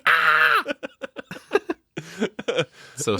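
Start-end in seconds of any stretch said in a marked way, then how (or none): 0.0-0.6 high airy fake shriek; breathy mock scream. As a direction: squeal it out, half laughing. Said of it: "she's like".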